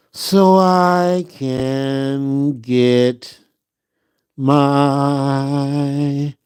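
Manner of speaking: mild drowsy bedtime chant; sleepy sing-song. Pretend. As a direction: stretch each phrase out like a lullaby.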